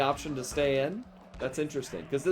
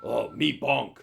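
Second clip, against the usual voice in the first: lightly voiced simple caveman; Bonk the caveman; slower than usual. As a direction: give a hint of a cheerful, halting caveman. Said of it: "he's a caveman, so he's like".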